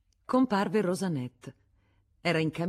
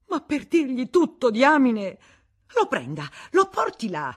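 loud, high urging; urgent exhortation; 8 semitones higher, 9 dB louder than usual. A narrator's reading. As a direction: push him into action. The Vatnaz urges Federico. (incitamento urgente e acceso)

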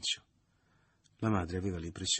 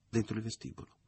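usual speaking voice

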